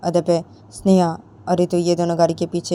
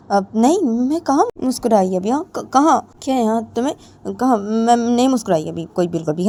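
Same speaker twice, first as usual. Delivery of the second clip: flustered denial; 5 semitones higher than usual. Acted. Ritu caught smiling, flustered denial.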